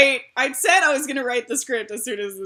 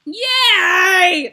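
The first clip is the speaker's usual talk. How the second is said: loud, high happy cheer; excited cheer; 8 semitones higher, 10 dB louder than usual. Real, not acted.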